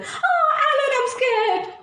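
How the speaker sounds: high, giggly mock-frightened squeak; scared beaver. Barry the beaver finding Alan asleep, high scared squeak. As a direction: squeak it like a startled little beaver. Beaver sees dozing alligator.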